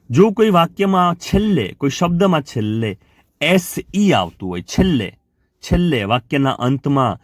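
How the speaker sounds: ordinary delivery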